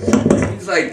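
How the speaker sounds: ordinary delivery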